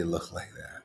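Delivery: hushed disbelieving whisper. Real, not acted. Preacher whispering his shock at the strange show. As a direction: breathe it out like a secret.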